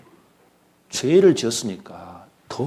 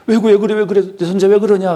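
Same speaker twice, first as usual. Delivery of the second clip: high, urgent fussing grandmother; worried questions tumbling out, storyteller's version; acted alarmed concern; 10 semitones higher, 5 dB louder than usual. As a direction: fuss over a crying grandchild, pitched up. Grandmother reacts to crying grandson.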